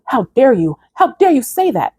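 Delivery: acting out a panicked, indignant demon. Demon reacting to her rebuke, mock panic. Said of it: "he started panicking".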